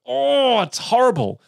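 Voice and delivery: high, squawking Australian bogan; Bogan accent. Shown as a demonstration. Host shows where the Bogan accent sits, high Australian squawk. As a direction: push a bogan accent from the back teeth. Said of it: "back of your teeth and right under your ears".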